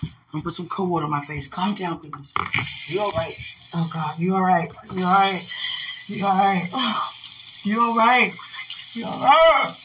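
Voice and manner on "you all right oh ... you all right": worried asking. Real, not acted. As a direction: ask it again and again, more scared. Repeated anxious asking.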